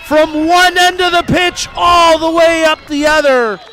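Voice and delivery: rising to a long, high, roaring goal call; long goal yell. Real, not acted. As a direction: stretch out the scores and roar it. Commentator calls a goal.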